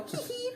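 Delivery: high giggle. Acted. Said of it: "I go".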